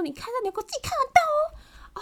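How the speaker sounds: high, harsh scolding; scary scolding. Retold or imitated. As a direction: order her to read it herself, harshly. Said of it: "超恐怖的欸".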